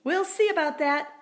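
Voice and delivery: high, loud cheeky retort; cheeky squeak. A narrator's reading. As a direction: toss back a defiant squeak. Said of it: "I squeaked".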